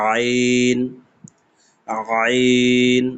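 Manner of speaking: throaty pressed আইন; Arabic ain demo. Shown as a demonstration. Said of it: "গলার কণ্ঠনালী থেকে চাপ দিয়ে বের হবে".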